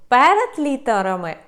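usual speaking voice

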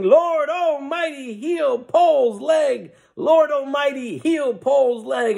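high, drawn-out crowd wail; mock screamed prayer. Crowd praying for Paul's leg, high acted shout. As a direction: cry it out like a revival congregation. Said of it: "they all start screaming".

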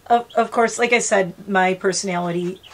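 ordinary delivery

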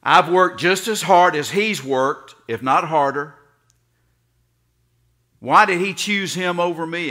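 hammed-up jealous rant; jealous mad boy. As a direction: shout it like the son who was passed over. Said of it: "was so mad".

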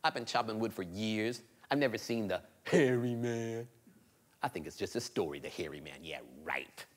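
scoffing to himself; doubtful boy thinking. Acted. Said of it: "he started thinking".

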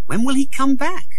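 impatient for the prince; anxious boy. A narrator's reading. Tom alone in the palace, high and anxious.

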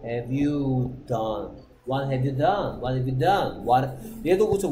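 usual speaking voice

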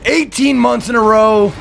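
high, drawn-out excited yell; big slow celebratory shout; hyped shout-out. Real, not acted. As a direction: holler the name like a hype man. Shouted thanks to a subscriber.

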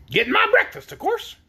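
high-pitched hen voice; reply as a hen busy pecking. A narrator's reading. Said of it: "murmured the hen, busily pecking away".

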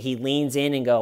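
usual speaking voice

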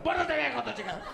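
mock yelling. Award winner yelling at his kids mid-thanks, mock scolding. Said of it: "yelling at him, too".

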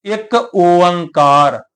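ਇੱਕ ਓੰਕਾਰ drawn out very slowly, ਓੰਕਾਰ stretched with a falling end; slow careful recitation. Shown as a demonstration. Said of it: "ਆਪਾਂ ਕੀ ਉਚਾਰਨ ਕਰਦੇ ਹਾਂ?". (ਇੱਕ ਓੰਕਾਰ ਬਹੁਤ ਹੌਲੀ, ਖਿੱਚ ਕੇ ਉਚਾਰੋ)

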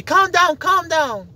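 hammed-up high condescending soother; mocking impression. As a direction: mimic someone soothing you condescendingly. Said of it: "they try to tell you".

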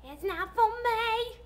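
high, loud, slow cry of relief; shouting with relief that the call isn't hers; relieved shout. Real, not acted. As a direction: burst out it's not for me. Answering machine not for her.

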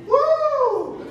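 sudden high whoop of joy; excited whoop. Real, not acted. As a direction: let out a joyful high woo. Preacher exclaims in excitement.

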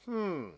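high, drawn-out pondering hum; musing storybook "hmm"; thoughtful hmm. A narrator's reading. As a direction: hum as if thinking it over. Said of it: "thinking carefully".

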